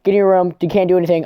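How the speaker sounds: acted phone scolding; bossy orders. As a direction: order him about, acting indignant. Voicing Ruka ordering him around.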